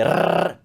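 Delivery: "R" as a long tongue-tip trill; rolled R. Shown as a demonstration. Teacher reassuring students who can't trill, long rolled "R". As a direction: roll "R" out at length. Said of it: "it does roll".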